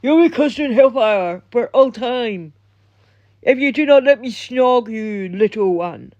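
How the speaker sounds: mock doom proclamation; hammed-up hellfire curse, falling away at the end; imagined prophet's curse. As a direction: put on an ominous, threatening voice. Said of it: "I don't know how that's how he talks".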